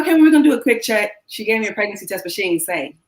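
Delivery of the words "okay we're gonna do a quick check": mock brisk doctor. Aunt-doctor reassuring tired niece.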